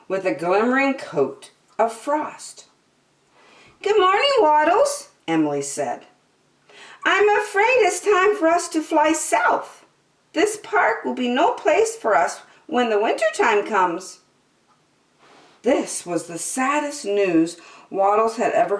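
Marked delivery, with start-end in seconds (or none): 3.8-5.1 high-pitched mother duck greeting; much higher storybook duck voice; Emily the duck. Acted. 7.0-14.2 high-pitched mother duck breaking news; storybook duck character, high voice; Emily the duck. Acted.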